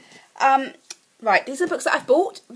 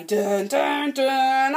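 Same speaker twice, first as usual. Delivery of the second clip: mock dramatic sting; 2 semitones higher, 4 dB louder, much slower than usual. Dramatic sting after book title.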